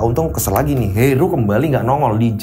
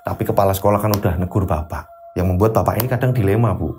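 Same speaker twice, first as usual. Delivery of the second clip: troubled husband; 8 semitones lower than usual. Acted. Teacher vents to wife.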